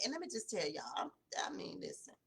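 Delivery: hushed, let the listeners in close; confiding whisper. Real, not acted. Speaking to her viewers, a hushed confiding whisper. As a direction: drop to a whisper, leaning in to share a secret.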